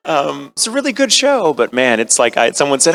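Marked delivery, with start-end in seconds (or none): none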